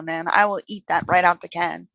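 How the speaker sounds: usual speaking voice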